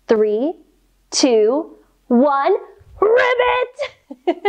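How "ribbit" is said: squeaky high frog croak; high cartoon frog ribbit. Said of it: "make our best ribbit sound".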